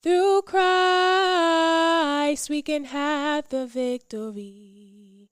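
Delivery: ordinary delivery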